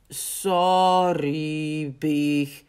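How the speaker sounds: drawn-out, sheepish mole voice; apologetic mole. A narrator's reading. Mol says sorry to Big, slow apologetic voice. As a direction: apologise slowly as a dopey mole. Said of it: "zegt Mol".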